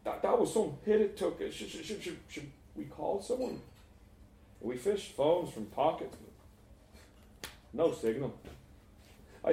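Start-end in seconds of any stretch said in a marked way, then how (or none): 0.0-3.6 high stammer, softening as it goes; shaken, hesitant. Acted. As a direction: stutter nervously towards a timid question. Shaken boy after the crash.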